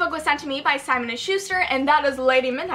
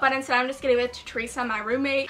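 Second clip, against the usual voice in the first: ordinary talk, nothing marked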